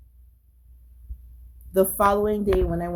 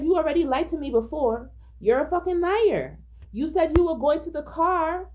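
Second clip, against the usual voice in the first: furious accusation at the officer, voice pitched up; angry retort; 7 semitones higher than usual. Retold or imitated. Man telling the officer he lied, higher-pitched angry accusation. Retold yelling at lying officer.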